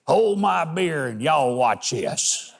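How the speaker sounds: put-on slow, drawn-out good-old-boy drawl. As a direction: put on a cocky hold-my-beer drawl. Mock quote of reckless network boast.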